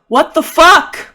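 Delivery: high, loud, drawn-out outburst; acted shriek of outraged bafflement; shocked shout. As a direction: yell the curse in total disbelief. Repeats it as escalating shocked outburst.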